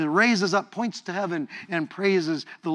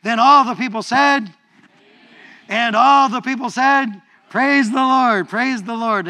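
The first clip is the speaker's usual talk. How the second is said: call and response shout; 5 semitones higher, 8 dB louder than usual. Real, not acted. Preacher leads congregation call and response, loud and high. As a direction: lead the room in a big shout. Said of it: "Let's do it".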